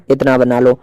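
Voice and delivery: ordinary talk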